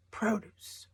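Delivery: PRO-duce, weight up front; stress on first syllable. Shown as a demonstration. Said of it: "is in the first syllable".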